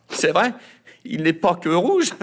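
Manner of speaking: high, loud brat voice; loud high kid butting in; cheeky boy. A narrator's reading. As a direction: outbid your sister, loud and high. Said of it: "renchérit Mimosa".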